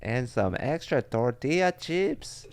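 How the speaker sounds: high, loud hammed-up Spanish accent; mock Spanish accent. Mocking a mom ordering at a Mexican restaurant, hammed-up Spanish accent. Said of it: "you say it in a Spanish accent".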